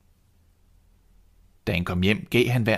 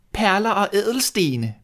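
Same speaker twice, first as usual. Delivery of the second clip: high, slow, greedy wish; greedy stepsister; 12 semitones higher, 3 dB louder than usual. Acted. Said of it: "bad den anden". (høj, langsom, grådig bøn)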